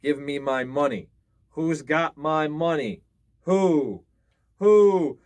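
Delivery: loud ghostly cry rising to a high wail; dead woman wailing for her coins. A narrator's reading. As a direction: call for the money like a lost spirit. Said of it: "the woman cried".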